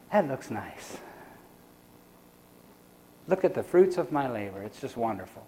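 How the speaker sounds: quiet contented reflection. Retold or imitated. Gardener admiring his finished yard to himself, hushed and contented. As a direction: murmur it as if admiring your own yard. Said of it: "you reflect and you say".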